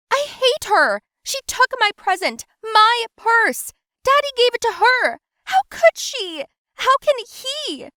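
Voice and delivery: spoiled daughter wailing to her mom; wailing teen rant. Acted. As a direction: whine it out like a betrayed child.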